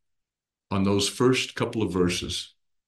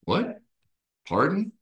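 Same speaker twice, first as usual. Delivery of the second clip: mock confused asking, jumping much higher; imitating a hard-of-hearing listener; 3 semitones higher than usual. Teacher imitating a listener who can't hear, rising higher. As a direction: play someone who can't catch the words. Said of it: "the way they say".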